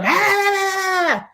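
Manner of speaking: long high-pitched "aah" yell; demonstrative holler, high and sustained; mock yell. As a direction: let out a drawn-out scream, imitating an angry yeller. Mimics how she used to yell.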